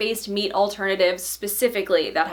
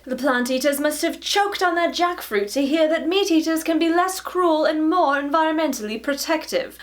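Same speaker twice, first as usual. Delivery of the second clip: high-pitched, put-on posh British reading; posh British accent; 7 semitones higher than usual. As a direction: read it aloud as an upper-class British writer. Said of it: "my poshest British accent".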